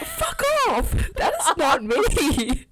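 loud, high indignant shriek; high-pitched, drawn-out outburst at a friend's teasing; outraged protest. Real, not acted. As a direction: shriek it in protest, dragging out the denial. Teased friend protests accusation.

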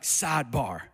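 lightly imitated church pastor, drawn out; preaching pastor. Pastor imitating a traditional church preacher, preaching pastor. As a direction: announce it like a pastor mid-sermon. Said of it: "the pastor will be preaching, and he'll be like".

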